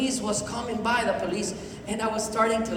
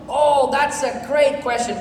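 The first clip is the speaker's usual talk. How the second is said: enthusiastic praise; 4 dB louder than usual. Shown as a demonstration. Coaching the audience to repeat after him, enthusiastic praise. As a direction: gush it slowly for them to copy. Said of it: "Can you repeat after me".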